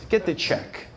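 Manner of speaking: normal talking voice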